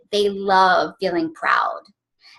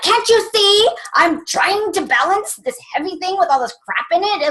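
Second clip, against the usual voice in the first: exasperated yell; 10 semitones higher than usual. Retold or imitated. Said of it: "sometimes I'm like".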